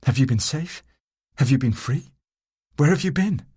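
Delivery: rising, anxious questioning; urgent questions. A narrator's reading. Lover asking where she has been, anxious and eager. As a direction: press her anxiously for answers.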